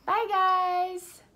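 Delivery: high, loud, drawn-out farewell; cheery sign-off. Real, not acted. Teacher signing off to lesson followers, cheery high call-out. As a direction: call goodbye out high, holding the last vowel. Playful sing-out goodbye to viewers.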